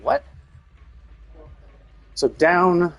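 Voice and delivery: ordinary talk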